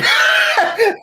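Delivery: loud high yelp; squealing shout; excited shout. Real, not acted. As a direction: burst out.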